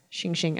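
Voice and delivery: sharp shing blade sounds; blade slash. Pretend. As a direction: make the sound of slashing daggers. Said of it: "hit it with my daggers".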